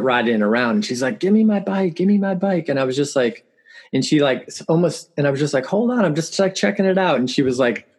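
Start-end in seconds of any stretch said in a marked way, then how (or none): 1.2-2.7 high-pitched imitation of a pleading kid; upset daughter. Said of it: "started crying about it". 5.6-7.2 higher-pitched retold protest; playful brush-off. Dad retells brushing off his daughter.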